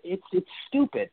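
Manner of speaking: normal talking voice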